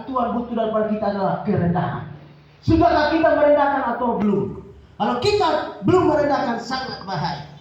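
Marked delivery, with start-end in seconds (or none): 2.7-6.6 preaching. Acted. Preaching at full force.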